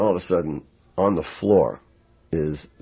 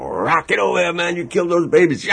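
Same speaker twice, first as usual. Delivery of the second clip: mock yell; 13 semitones higher than usual. Sly chasing him with bullwhip.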